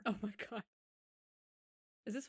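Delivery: whispered aghast. Real, not acted. Whispering in shock at a friend's reality-show recap. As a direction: breathe it out in shock.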